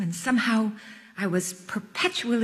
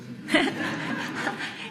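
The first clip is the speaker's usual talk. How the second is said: short airy laugh; breathy laugh; 9 semitones higher, 3 dB quieter than usual. A narrator's reading. Narrator amused by the rabbi's joke, airy laugh. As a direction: laugh quietly under the breath.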